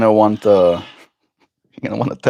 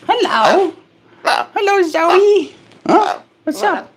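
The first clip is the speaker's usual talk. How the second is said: very high sing-song pet talk; much slower than usual. Real, not acted. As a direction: coo up high at the bird. Greeting the pet bird.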